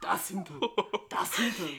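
understated German accent, half amused; put-on German voice. Shown as a demonstration. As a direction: say it with a comic German accent, contained. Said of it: "this voice when I talk German".

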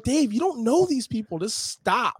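retold exasperated plea. Telling Dave to back off strangers, exasperated plea. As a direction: plead with a friend to quit it.

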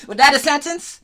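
high, loud incredulous "Was that a sentence?"; mock incredulous question. To a friend about mumble rap, loud high disbelief.

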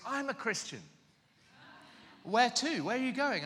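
high-pitched, excited retold questioning; eager secretary. Secretary learns the caller is on a mission trip, eager. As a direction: voice jumps up with keen interest.

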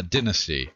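"dynasty" as DIN-uh-stee; variant pronunciation. Shown as a demonstration. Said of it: "I say dynasty and you say".

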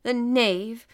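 a loud indignant outburst; angry cry. A narrator's reading. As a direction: spit the insult out loudly. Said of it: "cried Conseil".

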